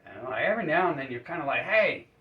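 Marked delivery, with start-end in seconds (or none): 1.6-2.1 loud, high hey to get attention; the chained man calling out to the doctor in the corner. Acted. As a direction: shout across the room to the doctor. Chained man calls across the room.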